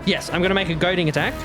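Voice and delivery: ordinary talk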